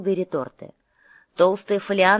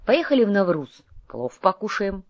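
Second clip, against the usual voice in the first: higher, livelier suggestion; perked up; 3 semitones higher, 3 dB louder than usual. A narrator's reading. Hungry Domingo proposes lunch to his partner, perked up. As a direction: brighten at the thought of food. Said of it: "оживился Доминго".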